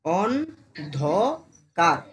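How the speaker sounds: অন্ধকার drawn out very slowly, syllable by syllable; slow teaching demo of অন্ধকার. Shown as a demonstration. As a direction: stretch out অন্ধকার slowly so each part is heard. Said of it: "উচ্চারণ কর ধীরে ধীরে".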